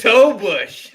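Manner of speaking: loud joking blurt. Real, not acted. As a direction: blurt the joke out.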